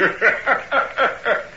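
breathy, wicked cackle; sinister villain's laugh; gloating laugh. Acted. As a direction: laugh at their fright. Hyde enjoys her fright.

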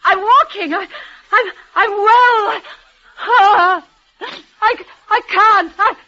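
high, straining to stay up; desperate insistence. Acted. Dying woman insists to her lover she is well, desperately. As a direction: fight the weakness, pleading.

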